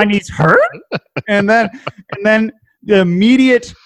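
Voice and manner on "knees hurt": "knees hurt?" rising like a question; questioning rise. Retold or imitated. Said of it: "more of a question".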